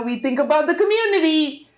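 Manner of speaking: high, rising rallying cry; impassioned appeal. Real, not acted. Politician urging the region to think community, impassioned.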